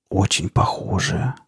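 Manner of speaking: slow, softened, awed murmur; spellbound whisper. A narrator's reading. Girl gazing at the artifact in her hands, spellbound murmur. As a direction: murmur it slowly, half to yourself. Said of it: "прошептала девушка, завороженно".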